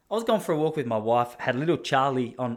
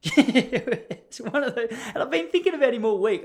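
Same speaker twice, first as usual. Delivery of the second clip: much higher, louder laughing talk; 10 semitones higher, 5 dB louder than usual. Real, not acted. Telling listeners about the stranger, laughing. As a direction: laugh through the words. Laughing at his own story.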